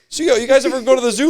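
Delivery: high, loud cheesy setup voice; mock hack comic. Mocking the bombing comic's zoo opener, high and cheesy. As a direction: send up a lame opener.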